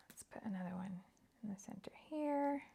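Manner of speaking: whispering to herself while crafting; soft unvoiced murmur as she works. Real, not acted. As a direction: breathe it out under her breath. Crafting, muttering to self.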